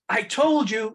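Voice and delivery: put-on high, loud kid's protest; hammed-up told-you-so; mock vindication. Acting out wee Michael's vindicated protest.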